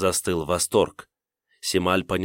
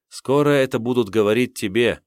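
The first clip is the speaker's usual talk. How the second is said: usual speaking voice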